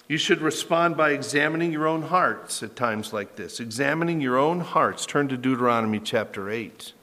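ordinary talk

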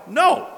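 "No" thrown out high in one firm burst; emphatic denial. Acted. Answers his own imagined objector.

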